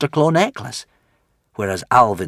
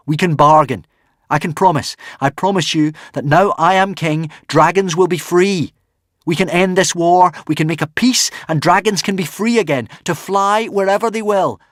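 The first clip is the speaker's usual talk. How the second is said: higher, louder earnest plea; 9 semitones higher, 6 dB louder than usual. Acted. Hiccup to the dragon, pleading earnestly. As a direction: plead for peace.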